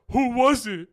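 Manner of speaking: high, loud cracking demand. Retold or imitated. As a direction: imitate a jealous boyfriend's voice breaking. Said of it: "his voice started cracking".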